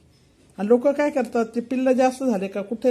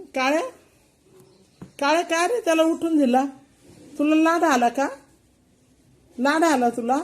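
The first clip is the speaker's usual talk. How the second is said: higher, louder mock scolding of a naughty cat; scolding pets; 4 semitones higher, 3 dB louder than usual. Real, not acted. Owner scolding the cat for hitting his brother, higher mock scolding.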